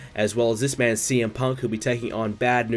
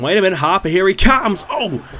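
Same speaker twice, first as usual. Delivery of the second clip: excited; 8 semitones higher, 7 dB louder than usual. Acted. Sudden attack in match.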